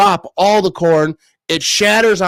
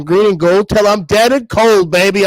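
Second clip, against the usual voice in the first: fan rallying cry; 5 semitones higher, 4 dB louder than usual. Real, not acted. Fan chant for the team.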